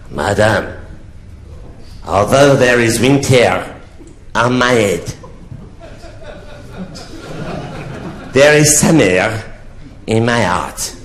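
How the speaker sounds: hammed-up French count's accent. French count wooing a prostitute, in a French accent. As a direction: play a little French count. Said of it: "he said to me in a French accent".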